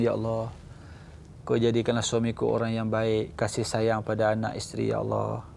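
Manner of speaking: low, soft whispered-style prayer; murmured prayer. Shown as a demonstration. Wife prays quietly behind her husband, soft and low. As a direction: pray softly behind his back. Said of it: "kita pun".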